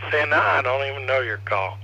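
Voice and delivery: ordinary talk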